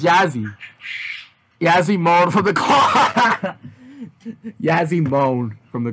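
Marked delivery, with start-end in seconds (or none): 1.6-3.6 laughing through the line. Real, not acted. Reading line while laughing. 4.0-4.6 laughing. Real, not acted. Breaks into laughter.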